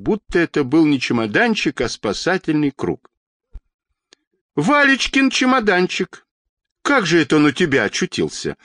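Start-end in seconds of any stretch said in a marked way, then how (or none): 4.6-8.6 very high exclamation, then hurried question; astonished gasp. A narrator's reading. Old woman seeing her grandson's suitcase, astonished. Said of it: "ахнула Анна Петровна".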